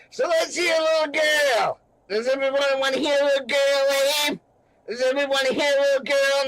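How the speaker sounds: high, pushed mocking voice; high-pitched gangster taunt; taunting gangster impression. Repeats the Nicky Santoro taunt.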